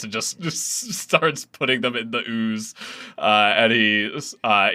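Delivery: ordinary delivery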